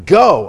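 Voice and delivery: "Go." shouted loud and sharp; imperative command. Shown as a demonstration. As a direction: bark out "Go." as an order. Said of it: "That's imperative".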